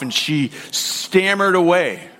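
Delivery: ordinary talk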